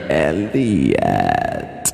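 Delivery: a light take on the movie alien saying Elliott; E T impersonation. Retold or imitated. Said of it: "that was my E T impersonation".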